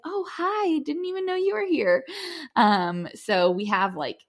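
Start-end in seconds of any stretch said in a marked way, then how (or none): 0.0-2.0 much higher, delighted surprise at someone being there; surprised hello. Retold or imitated. Greeting the second midwife she never noticed arrive, high surprised hello. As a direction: pop up high as if spotting a friend unexpectedly.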